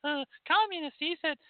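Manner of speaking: shrieking accuser, hammed-up; mock hysterical critic. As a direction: squeal it like a scandalised critic. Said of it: "run off and scream and yell".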